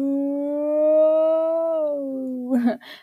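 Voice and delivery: a loud, high burst of laughter right into the mic. Real, not acted. After blasting listeners' ears, a loud high laugh. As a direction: cackle loudly at her own noise. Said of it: "that was very aggressive".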